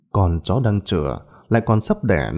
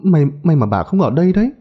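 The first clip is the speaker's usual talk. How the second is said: high-pitched, broken, panicky excuse; tearful, frightened stammer; 8 semitones higher than usual. A narrator's reading. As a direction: stammer through tears, terrified, pitched high. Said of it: "nước mắt rơi lã chã".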